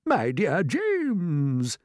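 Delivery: very slow, drawn-out fondness; affectionate old grasshopper. A narrator's reading. Grasshopper lays a leg on James's shoulder, affectionate. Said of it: "laying a front leg affectionately".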